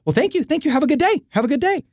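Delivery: high, hurried polite brush-off; flustered brush-off. Retold or imitated. Surfer brushing off [name], high and flustered. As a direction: rush him along politely, high.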